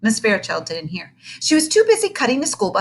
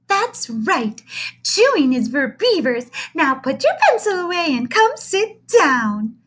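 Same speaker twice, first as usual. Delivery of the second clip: beaming teacher; 9 semitones higher, 4 dB louder than usual. Acted.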